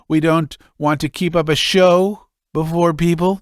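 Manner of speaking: father putting on a show before his son, high and loud; blustering old father. Acted. Drunk father boasting.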